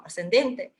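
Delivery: ordinary delivery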